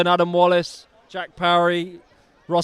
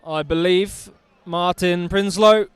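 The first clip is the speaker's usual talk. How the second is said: usual speaking voice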